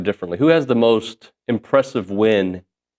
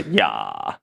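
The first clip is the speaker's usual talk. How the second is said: imitating Valley girl; 13 semitones lower, 6 dB quieter than usual. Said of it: "There was a".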